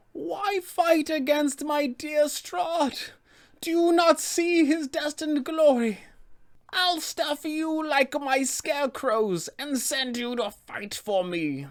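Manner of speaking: high, slow witch, scornful and threatening; Baba Lysaga. Acted. Witch belittles her attackers in battle, high threatening voice. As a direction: draw out the threats in a high crone-like voice. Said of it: "she will belittle them and berate them".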